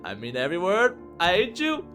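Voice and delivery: rising loud, angry retort; hateful declaration. Acted. Robin to pleading Yuri, loud hateful retort. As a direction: build to a high, loud finish.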